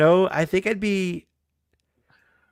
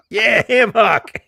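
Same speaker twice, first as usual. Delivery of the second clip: laughing through the name; 9 semitones higher, 4 dB louder, slower than usual. Real, not acted. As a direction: say it while cracking up.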